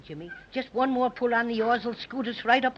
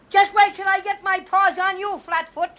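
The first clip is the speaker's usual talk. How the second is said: high, loud threat; mad; 6 semitones higher, 4 dB louder than usual. Acted. Bear threatening the policeman, mad. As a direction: fume and threaten him. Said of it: "Am I mad?".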